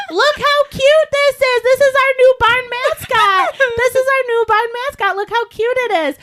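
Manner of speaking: gushing barn owner. Retold or imitated. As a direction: coo over it like a proud owner. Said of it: "she's like".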